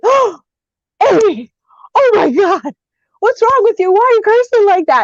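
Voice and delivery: high-pitched, scandalized scolding; shocked mother. Retold or imitated. Mother scolding her daughter for cursing, mock shock. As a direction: imitate an appalled mom, voice lifted high.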